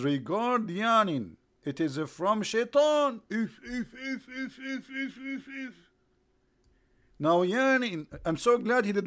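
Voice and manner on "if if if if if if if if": high, soft repeated puffs; little imitated snickers in short bursts; mock devilish snicker. Imitating shaitan over a yawn, high mock snickering.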